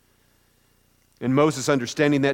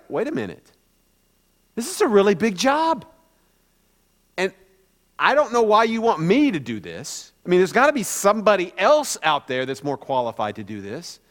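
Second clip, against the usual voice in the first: Moses taken aback; 3 semitones higher than usual. Acted. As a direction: balk at a really big job, questioning lift. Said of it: "Moses catches that. He's like".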